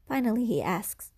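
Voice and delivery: ordinary delivery, nothing marked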